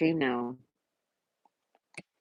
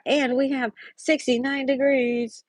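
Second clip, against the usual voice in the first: slow high voice; 7 semitones higher, slower than usual. Real, not acted. Weather report, repeated after.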